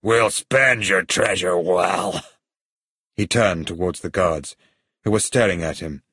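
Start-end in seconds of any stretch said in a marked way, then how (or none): 0.0-2.2 villain's slow, drawn-out toast; lightly voiced villain, savouring the words; gloating villain. Villain thanks dead pirate for treasure, gloating.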